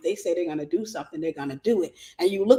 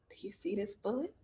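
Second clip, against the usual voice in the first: hushed, whispered mock threat; 14 dB quieter than usual. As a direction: whisper it like a retold menace. Retold threat in the car.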